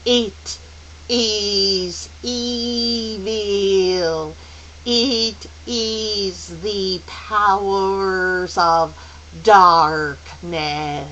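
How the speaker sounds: very slow, drawn-out, solemn intoning; otherworldly elder, slow and resonant; ringing pronouncement. Acted. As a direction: stretch each word out ominously. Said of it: "Missus Witch's voice rang out".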